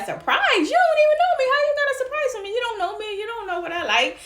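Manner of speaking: very high-pitched incredulous squeal; indignant disbelief. Retold or imitated. Girl answering a stranger's surprise, high indignant squeal. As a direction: shoot way up in pitch, outraged at the nerve.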